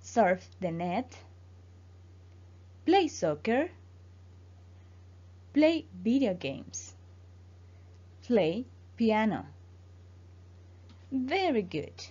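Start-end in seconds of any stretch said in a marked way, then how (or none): 0.0-9.5 slow, careful English model phrases for repeating. Shown as a demonstration. Teacher to Spanish-speaking student, pronunciation drill: slow careful model. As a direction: say each phrase slowly so the student can copy it. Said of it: "repite después de mí y así mejoramos tu pronunciación".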